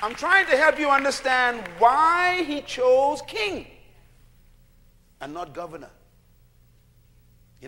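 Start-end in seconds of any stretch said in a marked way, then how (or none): 0.0-3.7 loud, rising call to the congregation; full-force preaching. Acted. Preacher calling out to his congregation, full-force preaching.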